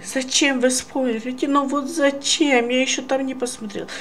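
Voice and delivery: high, whiny complaining voice, fast end; whiny commenter. Retold or imitated. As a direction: whine like a spoiled viewer. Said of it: "такой комментарий".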